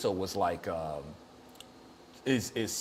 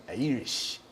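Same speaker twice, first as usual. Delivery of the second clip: slow, drawn-out stab at an Irish accent; attempted Irish accent; 3 dB quieter than usual. Shown as a demonstration. Host shows off his accent to co-host, attempted Irish accent. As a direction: try an Irish accent, stretching IRISH. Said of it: "how's my accent on it".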